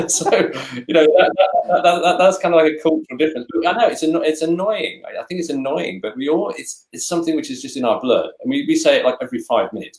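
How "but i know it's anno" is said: usual speaking voice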